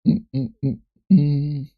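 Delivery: ordinary delivery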